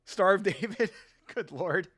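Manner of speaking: usual speaking voice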